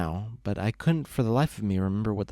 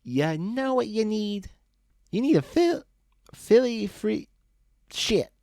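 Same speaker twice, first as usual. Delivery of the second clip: put-on high, sloppy drunk pony voice, much higher; drunk Loose Leaf; 12 semitones higher than usual. Drunk buddy lecturing Brick on the road, high slurry drunk voice. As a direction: ramble like a happy drunk friend.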